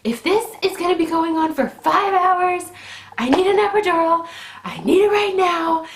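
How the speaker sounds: high, loud, frantic demand; retold screaming. Labouring woman screams at husband for epidural, high frantic yell. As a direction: re-enact losing it at your husband. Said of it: "start screaming at Matt".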